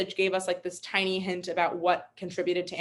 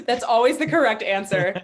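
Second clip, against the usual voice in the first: real laugh spilling into a louder, higher remark; amused laughing; 5 semitones higher, 6 dB louder than usual. Real, not acted. Host replying to a colleague's joke, laughing.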